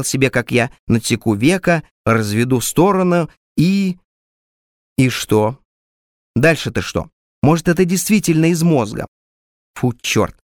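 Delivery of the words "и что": usual speaking voice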